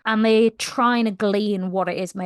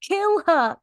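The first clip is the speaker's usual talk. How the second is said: higher-pitched, heated order to kill her; furious demand; 7 semitones higher than usual. Retold or imitated. Captain telling the room to kill her, heated and higher. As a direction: demand her death in a fit of rage. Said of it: "Rolf turns to the others in the room and's like".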